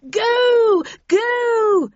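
very high, loud drawn-out yells; urgent yell. A narrator's reading. Havisham ordering escape from the virus, high urgent yelling. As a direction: scream the order to flee. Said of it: "she yelled at me".

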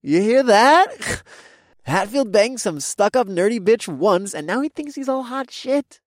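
mocking frat bro. A narrator's reading. Toby mocking.

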